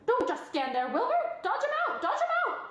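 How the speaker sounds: high, loud, excited goose voice with laughter; crying goose. A narrator's reading. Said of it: "cried the goose".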